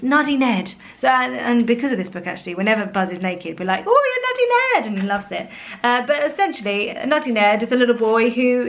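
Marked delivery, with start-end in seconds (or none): 3.9-4.9 put-on high-pitched cooing at a toddler; playful teasing exclamation. Parent to naked toddler, high playful teasing.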